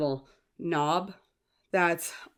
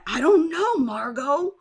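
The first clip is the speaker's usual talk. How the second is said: high, loud exasperated retort; hammed-up movie neighbor, pitched up; imitated snooty yuppie; 9 semitones higher, 7 dB louder, slower than usual. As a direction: snap it back as a mock snooty husband. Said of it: "those snooty, yuppie neighbors".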